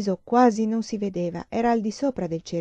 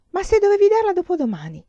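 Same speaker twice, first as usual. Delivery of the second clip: high, loud burst of disbelief; astonished objection; 12 semitones higher, 7 dB louder, faster than usual. Acted. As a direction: blurt it out, incredulous. Friend astonished at the news.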